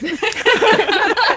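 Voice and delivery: high laughing burst; burst of laughter. Real, not acted. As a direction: laugh out loud. Laughing at own accent slip.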